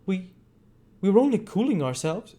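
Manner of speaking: high, nervous stammer. A narrator's reading. Bumble nervously answering Monks, high stammer. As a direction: stumble over the first word. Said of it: "stammered Bumble".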